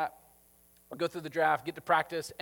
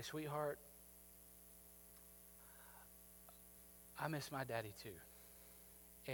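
tender comfort; 2 semitones lower, 9 dB quieter than usual. Retold or imitated. Coach kneels and tells grieving girl he misses his dad too, softly. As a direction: comfort her like a grieving friend.

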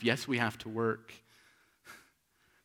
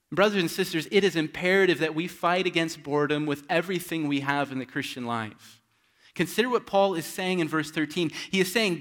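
loud, rising sermon cry; fervent preaching; 6 semitones higher, 9 dB louder than usual. Acted. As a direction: preach it out with full force to wake them. Preacher building to full force.

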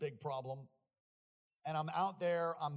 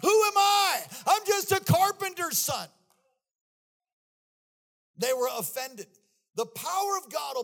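high, loud shouted preaching; full-force preaching; 15 dB louder than usual. Acted. Preacher exhorting the congregation, shouting high and loud. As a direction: preach it with everything.

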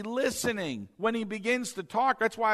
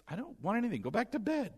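softer, higher voice of a man woken up; drowsy old Eli; 4 semitones higher, 7 dB quieter, faster than usual. Acted. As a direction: wave the boy off quietly. Eli woken by boy at night.

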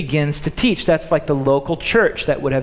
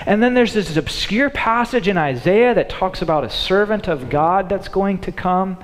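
ordinary talk

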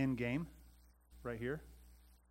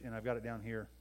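normal talking voice

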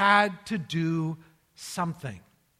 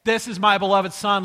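loud announcement at a normal pitch; retold divine proclamation, louder; voice from heaven; 9 dB louder than usual. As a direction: speak up firmly as the voice from the cloud. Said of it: "a voice that speaks up".

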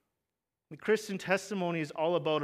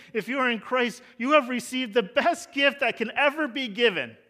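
louder and higher, driving the point home; rising preaching fervor; 6 semitones higher, 8 dB louder than usual. Acted. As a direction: build it up like a pastor at full force. Preaching at full force.

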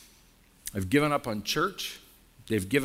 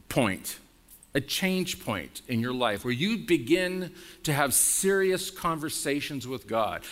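ordinary talk, nothing marked

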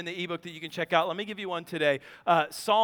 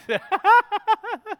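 high burst of laughter; 11 semitones higher, 7 dB louder than usual. Real, not acted. Laugh after kid voice.

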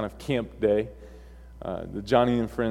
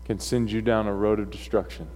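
solemn sermon closing; 5 semitones lower, 6 dB quieter than usual. Real, not acted. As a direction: bring the message home softly, with long pauses. Preacher's solemn closing exhortation.

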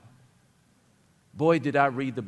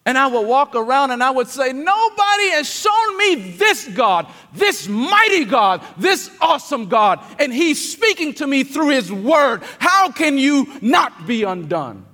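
loud, high, impassioned sermon climax; full-force preaching; 11 semitones higher, 10 dB louder than usual. Acted. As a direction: preach it out, building to a cry.

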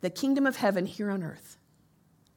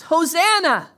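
loud, high Hosanna; shouted Hosanna; 6 semitones higher, 8 dB louder, slower than usual. Shown as a demonstration. Pastor praising God, loud shout. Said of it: "shout from the top of our lungs".

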